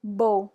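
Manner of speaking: crisp French "Beau", said as "boh"; silent final X. Shown as a demonstration. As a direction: model "Beau" loudly for a learner, ending on "oh". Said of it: "we just don't pronounce it".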